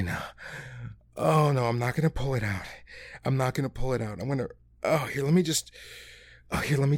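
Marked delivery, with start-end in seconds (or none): none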